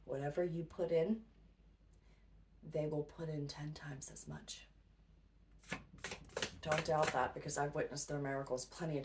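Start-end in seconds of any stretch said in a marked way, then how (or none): 2.7-4.7 whisper. Acted. Emphatic aside to viewers.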